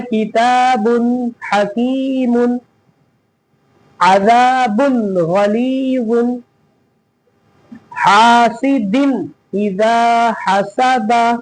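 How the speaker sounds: slow recitation, long vowels stretched in "किताबुन हकीमुन"; measured teaching recitation, as in "हासिदिन इजा हसदा"; matan recitation. Shown as a demonstration. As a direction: read "अजाबुन गलीजुन" syllable by syllable, drawn out. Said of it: "इस तरह करते हैं मतन".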